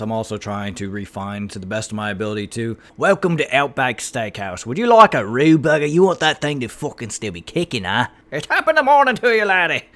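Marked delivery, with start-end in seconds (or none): 3.0-8.1 hammed-up Australian steakhouse greeter; broad, loud mock Australian accent. Shown as a demonstration. Greeting a customer at Outback Steakhouse, broad put-on Australian accent. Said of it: "with a lot of my accents". 8.3-9.8 high, loud, hammed-up Irish accent; bad Irish accent. Shown as a demonstration. Wishing a laddie good morning, loud cartoonish Irish accent. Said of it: "My Irish is actually really bad".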